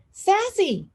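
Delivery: name called out high; teacher calling to a student, high call. Acted. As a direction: call her name across the room. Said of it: "she called out".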